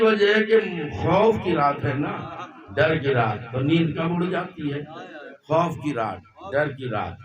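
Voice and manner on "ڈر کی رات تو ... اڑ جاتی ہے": ordinary talk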